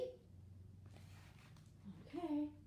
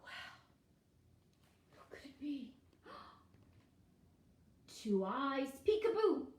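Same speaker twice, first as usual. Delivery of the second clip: ordinary delivery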